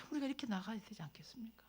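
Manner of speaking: soft unvoiced question to the congregation; whispered appeal. Sermon appeal.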